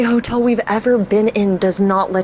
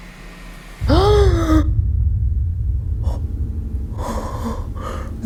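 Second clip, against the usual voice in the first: sharp shocked gasp; 9 semitones higher than usual. Real, not acted. Shocked by mom's text.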